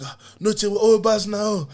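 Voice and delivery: high-pitched imitation plea; mock pleading crowd. Crowd pleading at the car, mock protest.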